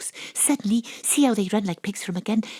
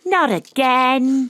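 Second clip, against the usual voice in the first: slow loud dread; 5 semitones higher, 7 dB louder, much slower than usual. Acted.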